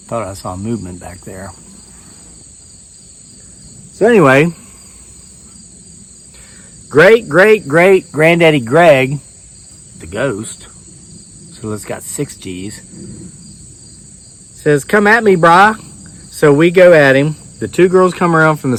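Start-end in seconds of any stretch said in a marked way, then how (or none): 0.1-1.5 hushed, low and much softer; quiet aside. Real, not acted. Comedian murmurs to the crowd about movement. 14.8-15.8 taunt. Retold or imitated. Ghost taunting the attackers.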